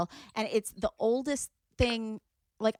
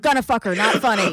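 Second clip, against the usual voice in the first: dudebro voice; 2 semitones higher, 7 dB louder, faster than usual. Retold or imitated. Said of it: "guys that were like".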